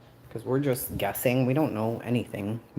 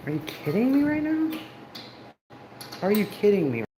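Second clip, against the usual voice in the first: high-pitched mock disbelief, far above her usual voice; incredulous outburst; 9 semitones higher than usual. Streamer reacting to absurd chat comments, high incredulous squeal. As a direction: squeak out the disbelief like you can't believe it. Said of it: "I'm like".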